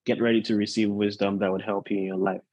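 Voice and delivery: normal talking voice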